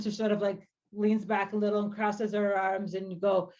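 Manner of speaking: ordinary talk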